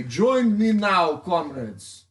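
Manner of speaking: put-on lightly done Russian accent speech; rally the crowd in a Russian accent. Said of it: "I have a Russian accent".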